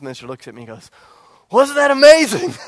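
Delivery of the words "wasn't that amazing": high, loud burst of imitated enthusiasm; mock elation. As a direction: exclaim it like an overjoyed youth minister. Youth minister elated after disaster.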